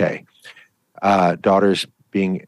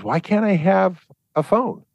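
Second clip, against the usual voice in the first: put-on much higher pleading kid voice; whiny daughter; 10 semitones higher than usual. Daughter begs parent for a phone, high whiny plea. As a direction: whine like a teen denied a phone. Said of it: "Your daughter says".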